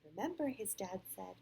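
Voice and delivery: clear, animated read-aloud; storybook voice with lively rises and falls; picture-book narration. A narrator's reading. Parent reads Sam's story to a child, animated storytelling.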